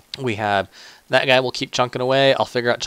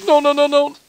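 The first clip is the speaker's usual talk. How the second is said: high, loud panicked no; panicked shout; 9 dB louder than usual. Real, not acted. Gamer watching his base explode, high loud panicked shout. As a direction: yell in panic.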